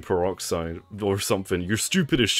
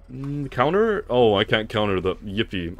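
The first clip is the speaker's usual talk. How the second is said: ordinary talk, nothing marked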